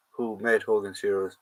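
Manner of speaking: ordinary delivery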